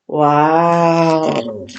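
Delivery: ordinary delivery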